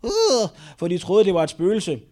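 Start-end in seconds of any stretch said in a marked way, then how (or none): 0.0-0.5 high, loud "uuh" of fright; short acted shriek, like in a film; playful scream of terror; mock horror scream. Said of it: "skreg de af rædsel".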